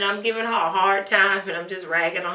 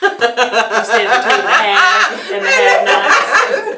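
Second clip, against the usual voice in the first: cracking up over the wrong title; laughing; 3 semitones higher, 9 dB louder, slower than usual. Real, not acted. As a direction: laugh through the words.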